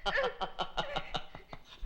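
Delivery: a sudden high laugh that falls away; high burst of laughter. Acted. Husband bursting into laughter at his wife's mix-up.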